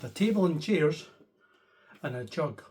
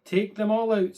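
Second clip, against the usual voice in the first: higher, louder confident advice; wise old man; 5 semitones higher, 7 dB louder than usual. Acted. Wise old man telling the lady to take her hen. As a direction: announce the plan brightly.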